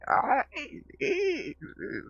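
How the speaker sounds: very high, drawn-out eerie wail; horror sounds. Acted. Streamer just making horror sounds, eerie wail. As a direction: stretch it into a loud, high horror noise. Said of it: "horror sounds".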